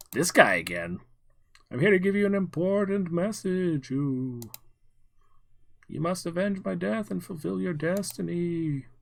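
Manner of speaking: high, eerie ghostly voice; ghostly spirit. Acted. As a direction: moan the prophecy like a spirit. Ghostly vision in hallucination.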